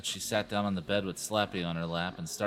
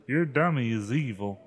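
usual speaking voice

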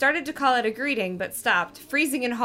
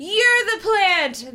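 slow, high, louder accusation; accusing; 10 semitones higher, 5 dB louder, much slower than usual. Acted. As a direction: point and accuse him.

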